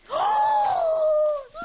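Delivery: loud, high, drawn-out gasp; huge shocked gasp. Real, not acted. Seeing the surprise birthday gift, shocked gasp. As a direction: gasp sharply in sheer astonishment.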